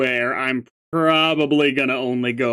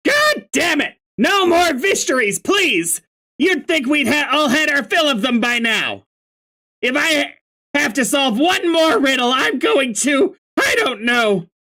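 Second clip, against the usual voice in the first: Karkat exasperated retake; 11 semitones higher than usual. Acted. Karkat redoes his riddle rant, shouting high. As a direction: explode upward, sick of mysteries.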